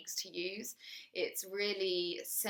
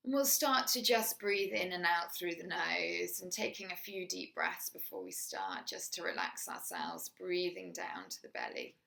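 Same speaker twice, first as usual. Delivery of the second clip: guided meditation; 4 dB quieter than usual. Acted. Teacher guiding a listener through a body scan, soft meditation voice. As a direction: ease the listener into the body, gently.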